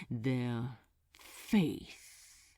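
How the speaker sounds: low, spit out faith with disgust; a disgusted demon hissing the word faith; hissed in disgust. Acted. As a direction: let faith curl off the tongue as an insult. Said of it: "He hissed the word in disgust".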